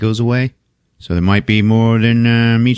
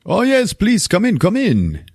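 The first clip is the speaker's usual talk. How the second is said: much higher, swooping welcome; hammed-up imitation of an effusive host; eager welcoming host; 12 semitones higher, slower than usual. Javier lets the visitor come in, hammed-up high and eager. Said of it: "So Javier is like".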